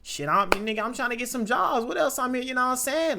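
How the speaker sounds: hyped slang voice. Pretend. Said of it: "I'm not gonna be like".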